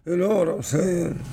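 put-on comic's voice; Bernie Mac. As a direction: stretch it like a stand-up catchphrase. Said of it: "In my Bernie Mac voice".

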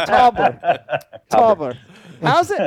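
cracking up on the name; laughing. Real, not acted. Host amused by his own botched name, laughing.

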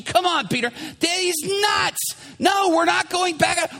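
high, emphatic mock protest; exasperated refusal.

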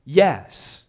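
acted emphatic answer, a firm loud "Yes". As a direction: hit "Yes" hard and short. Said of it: "they answer with an emphatic".

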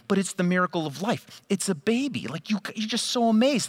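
mock the starry-eyed baby admirer, lightly; gushing listener. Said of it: "you might be sitting there thinking".

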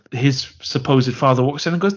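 ordinary delivery

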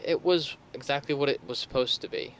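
ordinary delivery

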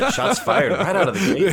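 high, loud, laughing through the words; laughing exclamation. Real, not acted. Host reacting to the guest's jab at Swedish accents, laughing loud. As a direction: crack up while calling it out.

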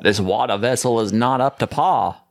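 imperfect put-on Georgian accent. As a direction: try a genteel Georgian accent, dropping the r. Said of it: "not the best Georgian accent I can do".

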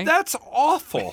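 high, drawn-out disbelief; appalled. Real, not acted. Co-host reacting to the lawyers' weak strategy, appalled. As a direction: stretch it out high, aghast at the idea.

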